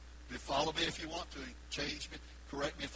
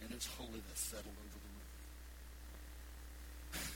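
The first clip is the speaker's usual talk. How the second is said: much softer, lower, reverent hush; 5 semitones lower, 12 dB quieter than usual. Real, not acted. Preacher describing holy hush, hushed and soft. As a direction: let it fall to a hush.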